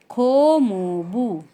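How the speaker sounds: "खो मो बू" slow, each syllable separate; teacher showing the extra syllable; syllables spelled out. Shown as a demonstration. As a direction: break "खो मो बू" into syllables. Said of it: "extra syllable लग गया बू".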